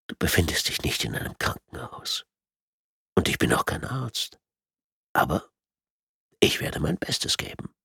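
softly spoken, understated menace; lightly voiced villain, hushed at the end; calm sadistic tormentor. A narrator's reading. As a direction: quietly taunt a helpless captive. Villain taunts the captive.

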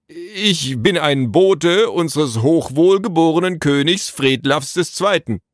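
high, loud, slow-starting proclamation; pompous royal messenger. A narrator's reading. Royal messenger asserting his rank to Naru, pompously. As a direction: announce the office with full pomp.

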